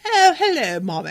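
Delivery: high, loud hammed-up posh British "Oh, hello, mommy"; mock posh British accent. Mocking how people fake a British accent, hammed-up posh British. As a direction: overdo a snobby British accent. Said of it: "a lot of people think a British accent is just like".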